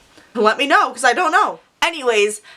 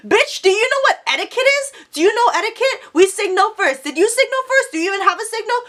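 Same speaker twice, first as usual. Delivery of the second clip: high-pitched rapid-fire mock outrage; hammed-up, high, indignant challenge; retold angry confrontation; 9 semitones higher than usual. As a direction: fire indignant questions in a much higher voice. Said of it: "I was still screaming".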